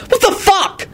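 high, startled yell; indignant high-pitched exclamation. Acted. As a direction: cry out in outrage. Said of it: "Quentin yelled".

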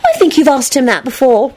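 put-on high, helpful girlish voice; affable. A narrator's reading. Rosie chimes in to Lupin, high and affable. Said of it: "Rosie put in affably".

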